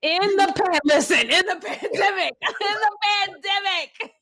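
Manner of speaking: high, laughing disbelief. Real, not acted. Repeating the friend's question, laughing in disbelief. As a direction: squeal it through laughter.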